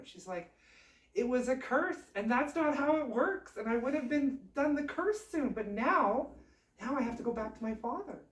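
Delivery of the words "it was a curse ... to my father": high, loud exasperated princess; acted outburst, pitched up and pushed; upset scolding. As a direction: scold him for ruining everything. Princess scolds prince over burnt skin.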